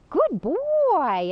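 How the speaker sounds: high, loud, drawn-out praise for a dog; delighted-sounding high-pitched dog praise. Shown as a demonstration. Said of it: "I can praise him after he does it".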